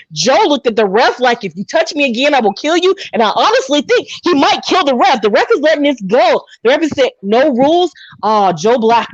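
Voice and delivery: live play-by-play, rising with the action; wrestling commentary. Acted. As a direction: call the fight like a ringside commentator. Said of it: "you have to commentate".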